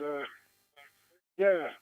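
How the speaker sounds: usual speaking voice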